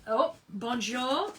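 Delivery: put-on slow French-accented bonjour; a playful French bonjour; light French accent, slow; French greeting. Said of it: "Quebecer in the house".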